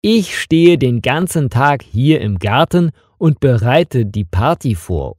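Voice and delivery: ordinary talk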